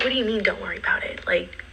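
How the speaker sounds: fast, urgent whispered question; retold whisper. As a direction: hiss it out fast under your breath. Retold panicked question to friend.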